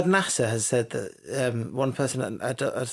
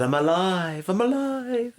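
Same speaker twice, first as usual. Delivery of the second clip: quoting the Master; 6 semitones higher than usual. Retold or imitated. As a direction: imitate a triumphant villain, stretching it higher the second time. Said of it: "he also said".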